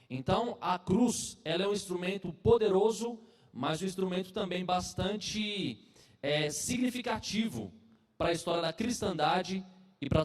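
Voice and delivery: earnest sermon delivery, rising emphasis; preaching. Acted. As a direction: preach it to the congregation.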